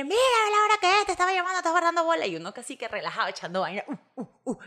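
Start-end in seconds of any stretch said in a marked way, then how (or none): 0.0-2.3 high, loud mock scolding; mock scolding partner. Wife scolds late husband: loud, high mock scolding. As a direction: nag him about the late hour, hammed up. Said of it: "entonces uno dice".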